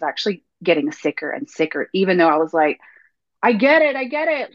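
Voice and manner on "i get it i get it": high-pitched, retold giving in; exasperated concession. Speaker answering her body while getting sicker, exasperated concession. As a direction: concede it high, first one louder.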